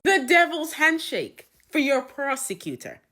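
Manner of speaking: a hint of booming judge; sentencing judge. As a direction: announce the punishment with mock grandeur. Mock judge passing a sentence.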